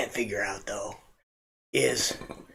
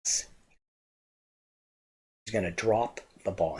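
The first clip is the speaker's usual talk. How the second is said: normal talking voice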